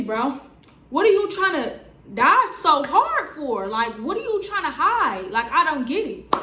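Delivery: loud, high, exasperated questioning; heated rant. Real, not acted. Telling viewers about the boyfriend dodging her, loud and exasperated. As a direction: demand to know what he's hiding, heated.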